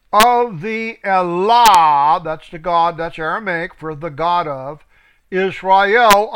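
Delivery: loud, slow, ringing proclamation; preaching. Acted. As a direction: proclaim it to the hall. Proclaims scripture loudly.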